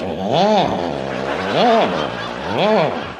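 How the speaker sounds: low, strained moans of the water; lightly voiced gurgling groan; groaning toilet water. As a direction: voice a choking toilet. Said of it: "It just goes".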